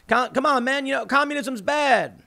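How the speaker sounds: hammed-up Biden sneer; mock Joe Biden. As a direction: put on a doddering old-man Biden voice. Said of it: "if Joe Biden comes out and says".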